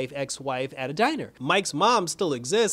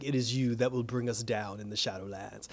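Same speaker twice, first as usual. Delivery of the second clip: lower, softer, menacing villain voice; the Shade ominous; 4 semitones lower, 6 dB quieter than usual. Retold or imitated. The Shade warning Pat about the Shadowlands, low and hushed. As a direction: sink the voice low and quiet like a threat. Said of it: "he's like".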